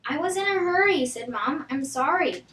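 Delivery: ordinary delivery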